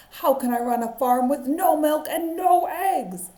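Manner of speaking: loud, high furious outburst. Acted. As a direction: rage at the striking animals. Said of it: "Farmer Brown was furious".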